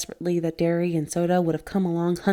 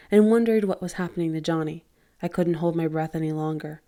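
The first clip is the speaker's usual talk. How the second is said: ordinary delivery, nothing marked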